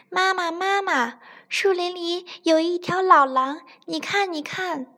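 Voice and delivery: put-on high, breathless child voice calling to mother; a scared little rabbit running home to mom; frightened little bunny. A narrator's reading. Little rabbit tells mother about the wolf, high and frightened. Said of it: "小白兔吓得直哆嗦".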